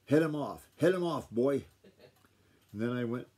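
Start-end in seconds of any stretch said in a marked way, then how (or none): 0.1-1.7 urgent shout, louder and higher; man's yell. A narrator's reading. Man yelling to a boy to head off the hog, urgent shout. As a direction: yell an order across the barn. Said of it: "a man yelled".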